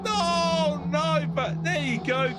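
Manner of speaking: high, lively play-by-play calls; race commentary. Real, not acted. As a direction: call the race as it happens, rising with every move.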